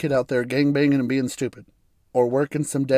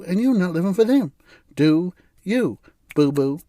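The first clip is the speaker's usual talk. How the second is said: higher, sassy motivational voice; higher, hyped-up motivational influencer voice; Instagram coach impression; 4 semitones higher than usual. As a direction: channel a sassy influencer pep talk. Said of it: "she's like".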